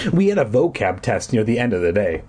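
ordinary delivery

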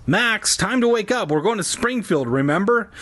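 high-pitched mom from the hallway; mother's high wake-up call. A narrator's reading. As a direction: call out to wake your kid. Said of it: "It was my mom out in the hallway".

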